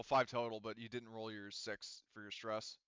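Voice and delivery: ordinary talk, nothing marked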